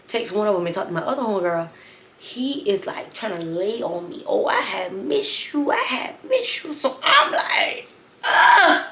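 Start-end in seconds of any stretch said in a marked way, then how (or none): 4.3-6.7 slow, high mock lovesick drone; a hint of a sloppy drunk guy; mocking drunk suitor. Mocking drunk man's advances. 8.2-8.8 loud breathy groan of disgust; disgusted ugh. Retold or imitated. Disgusted reaction to him.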